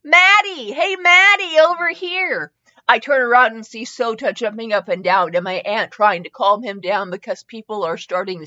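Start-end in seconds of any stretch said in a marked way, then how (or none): 0.0-2.5 high, loud call across a crowd; yelling. A narrator's reading. Someone yells to Maddie at the airport, yelling. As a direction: holler the name to get her attention. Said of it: "Someone yell".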